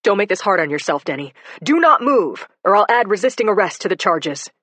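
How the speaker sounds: loud, hard order; stern warning. Acted. Cop threatening to add resisting arrest, loud stern order. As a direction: warn him not to resist.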